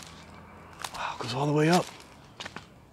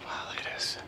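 awed whisper; hushed, barely voiced wonder; 13 dB quieter than usual. Real, not acted. Awed at storm damage.

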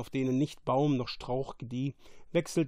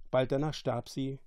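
usual speaking voice

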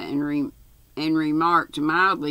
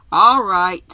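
loud, cheerful agreement; 3 semitones higher, 11 dB louder than usual. A narrator's reading. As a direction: chirp it brightly and much louder. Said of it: "said Tuppence cheerfully".

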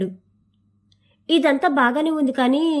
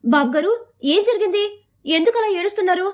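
put-on higher, anxious village girl's voice; girl finding a man crying at a door, anxious and higher; worried girl; 6 semitones higher than usual. A narrator's reading. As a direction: rush in concerned, a young girl's worry. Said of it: "కంగారుగా సురేష్ దగ్గరికి వచ్చి".

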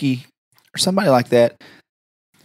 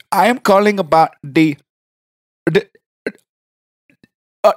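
prank caller voice broken into fragments; phone cutting out; 5 semitones higher than usual. Acted. Caller faking a bad line to the receptionist, cutting out. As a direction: drop out mid-sentence like a bad connection. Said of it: "your phone keeps cutting out".

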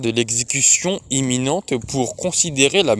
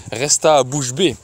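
usual speaking voice

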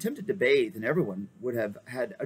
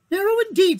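much higher small mouse voice, eager and courtly; a brave little mouse offering his service; gallant mouse; 10 dB louder, faster than usual. Acted. The mouse knight offers to dive for the king, high and eager.

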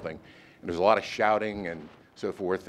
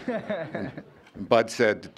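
slow words through a laugh; chuckling as he sets up the punchline; 4 semitones higher, much slower than usual. Real, not acted. Speaker laughing at his own Kissinger story, slowly chuckling.

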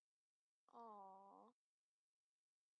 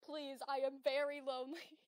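comically desperate plea, much louder; mock plea; 3 semitones higher, 16 dB louder than usual. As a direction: overplay the loneliness as a joke. Joking dialogue choice.

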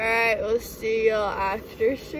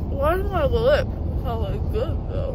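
loud, loopy and giddy; groggy from anesthesia; 8 dB louder than usual. Real, not acted. Drugged patient, loud and giddy.

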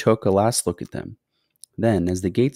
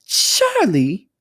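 high, loud cry of welcome; thrilled, fluty exclamation; delighted cry; 10 semitones higher, 6 dB louder than usual. A narrator's reading. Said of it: "cried Mister Wonka".

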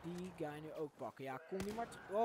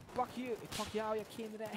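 mock threat; 7 semitones higher, 5 dB louder than usual. Mock threat at enemy, then grapje.

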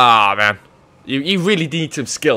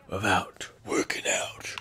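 whispered with no voice, like a secret; mock whisper; 7 semitones lower, 13 dB quieter, slower than usual. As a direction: breathe it out as a smug aside. Bragging about abs.

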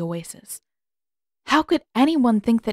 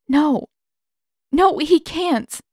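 distraught denial; 7 semitones higher, 5 dB louder, slower than usual. Acted. Katara fears Zuko's exile.

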